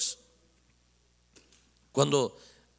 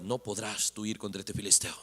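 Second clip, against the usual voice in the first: doubtful, low; 9 semitones lower, 10 dB quieter than usual. Acted. Saul doubting David.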